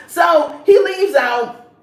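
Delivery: loud drawn-out narration. Real, not acted. Vlogger loudly recapping the scene for viewers. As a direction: stretch it out for drama.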